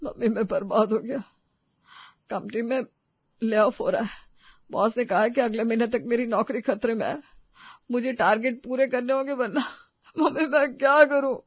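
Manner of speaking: acted tearful despair. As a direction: break down helplessly to mother. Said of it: "अचानक माँ की गोद में सर रख रोने लगा". (रोते हुए घबराया बेटा)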